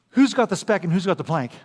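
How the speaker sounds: usual speaking voice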